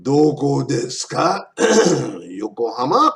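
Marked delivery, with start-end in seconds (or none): 0.0-1.5 "Doko desu ka" slow, rising on "ka"; question intonation. Shown as a demonstration. Teacher showing a Japanese question, slow "Doko desu ka" rising on "ka". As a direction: spell out the question, lifting "ka" at the end. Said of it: "That's a question".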